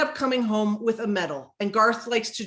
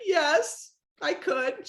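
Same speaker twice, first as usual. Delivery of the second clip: retold tearful assent; 5 semitones higher, much slower than usual. Crying client agreeing to trainer's request, mock-tearful giving in. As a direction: give in like a sniffly client.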